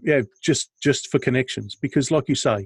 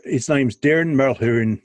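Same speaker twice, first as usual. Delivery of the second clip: slow, lightly put-on Irish accent; slow playful Irish accent. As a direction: drop into a quick joke Irish accent for the name. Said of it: "that was my Irish accent".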